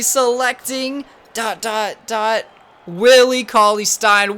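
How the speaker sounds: slow, high, building dramatic reveal; draft announcer. Pretend.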